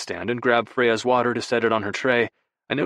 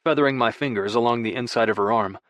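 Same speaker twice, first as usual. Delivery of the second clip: usual speaking voice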